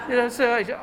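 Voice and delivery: talking through a laugh; words carried on a chuckle. Real, not acted. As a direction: still laughing as you speak. Still laughing after the laugh.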